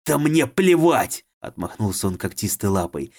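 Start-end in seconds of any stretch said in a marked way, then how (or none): 0.0-1.2 slow, higher, louder outburst; furious shout. A narrator's reading. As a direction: hurl it away. Furious dismissal.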